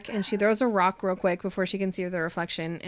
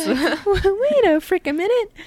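high, loud, drawn-out mock disbelief; comic outburst of astonishment, pitched way up; mind blown; 10 semitones higher, 8 dB louder, slower than usual. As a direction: act out someone utterly floored, hammed-up. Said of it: "blows Artemis's mind".

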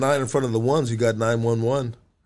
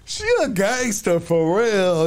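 laughing hard; 13 semitones higher, much slower than usual. Real, not acted. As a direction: crack up while talking. Cracking up at the guest.

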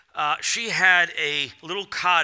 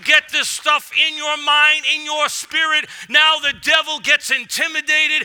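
loud, high fired-up sermon voice; full-force preaching; 8 semitones higher, 5 dB louder than usual. Acted. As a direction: preach it out to the whole church, louder and higher.

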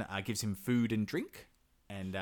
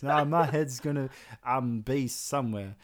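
fumbling dolt; 3 semitones higher, 4 dB louder than usual. Pretend. Ivan fumbles the answer.

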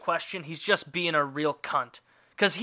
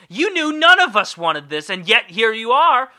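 louder, higher indignant outburst; pitched-up, fed-up scolding; exasperated accusation; 6 semitones higher, 10 dB louder than usual. Acted. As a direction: call out the guest in loud exasperation. Acted complaint to a guest.

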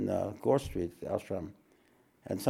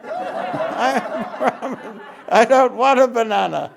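laughing shout; 10 semitones higher, 9 dB louder, slower than usual. Retold or imitated. Teacher repeats the punchline laughing.

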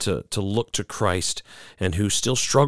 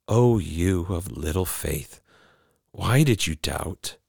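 soft, slow and lower, kindly correcting; gentle reproof; 4 semitones lower, 4 dB quieter, slower than usual. A narrator's reading. Jesus gently rebuking Peter, soft and slow. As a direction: rebuke him tenderly, slowly. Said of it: "the gentle reproof".

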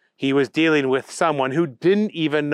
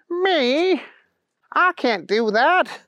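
high, stretched-out disbelief; dismayed Booger; 12 semitones higher, much slower than usual. A narrator's reading. Booger told to deliver the candy, high dismay. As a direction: squeak it out, then protest slowly.

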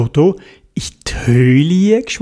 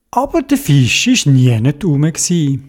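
plain reading voice

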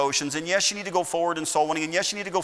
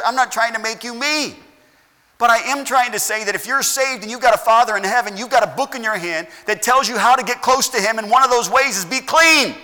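louder and higher, building to a peak; rising preaching; 6 semitones higher, 8 dB louder than usual. Real, not acted. Pastor urging the saved congregation to be clean, building loud and high. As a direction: drive it home, climbing toward be clean.

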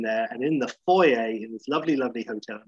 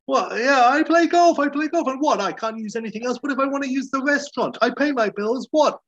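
much higher, louder whiny outraged voice; Larry David impression; 13 semitones higher, 5 dB louder than usual. Larry protesting to the golf manager, in a high whiny outrage. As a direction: squeal it like an indignant nebbish. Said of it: "And Larry's like".

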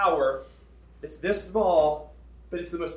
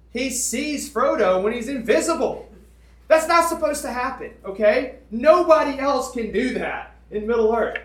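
amazed emphasis; 8 semitones higher, 9 dB louder than usual. Real, not acted. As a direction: hammer home how impossible this is. Amazed at Tom's power.

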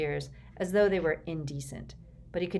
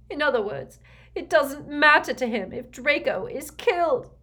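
high, loud bitter outcry; distraught; 9 semitones higher, 7 dB louder than usual. A narrator's reading. Mother realising her son is expendable, distraught. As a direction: cry out in anguish, pitched well up. Narcissa's distressed retort to Snape.

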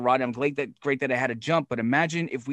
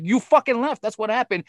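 exasperated scolding; 8 semitones higher than usual. Retold or imitated. Promoter retells calling Roddy, exasperated high scolding. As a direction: re-enact yelling at him down the phone.

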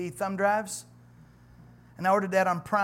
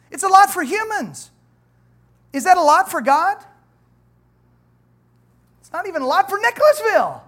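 emphatic preaching; 9 semitones higher, 9 dB louder than usual. Acted. Preacher's rising emphatic point.

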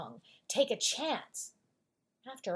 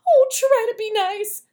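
high, loud, terrified whimper; frightened lion whimpering at the mouse; whimpering plea; 11 semitones higher, 16 dB louder than usual. Acted. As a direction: beg with a crying whimper. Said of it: "he whimpered".

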